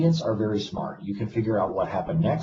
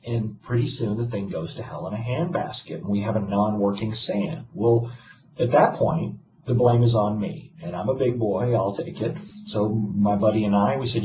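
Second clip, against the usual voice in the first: normal talking voice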